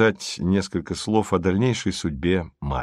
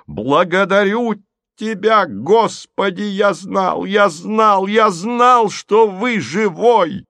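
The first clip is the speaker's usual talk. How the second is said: высокий, протяжный вопль счастья; прокричи это ему в лицо от счастья; восторженный крик; 10 semitones higher, 4 dB louder, slower than usual. A narrator's reading. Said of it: "Это он прокричал уже в лицо". (ликующий крик, высоко и нараспев)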